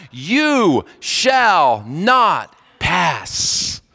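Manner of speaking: very slow, drawn-out decree; Gandalf's third command. Retold or imitated. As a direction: stretch every word, final and firm. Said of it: "He says it a third time".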